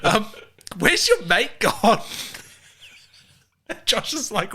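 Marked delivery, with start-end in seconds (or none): 0.8-2.0 high, slow, drawn-out query; retold dawning confusion; baffled question. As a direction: ask it slowly with rising puzzlement. Retold baffled question about missing passenger.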